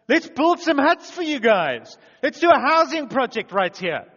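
lightly mock the naive eager apostle; eager Peter. Peter voiced blurting silly idea.

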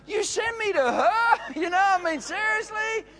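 high, loud, laughing disbelief; can you believe this, laughing in outrage; incredulous outburst. Acted. Elijah protesting to God, laughing in outraged disbelief.

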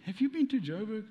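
incredulous what. Retold or imitated. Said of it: "we looked at them and said".

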